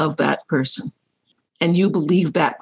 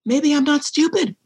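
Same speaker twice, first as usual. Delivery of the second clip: dawning realization; 8 semitones higher than usual. Retold or imitated. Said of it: "And I went".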